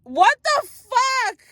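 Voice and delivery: slow, drawn-out high disbelief; high, slow stunned outburst; shocked disbelief. Real, not acted. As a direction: stretch it out in shock. Shocked at broken gummy.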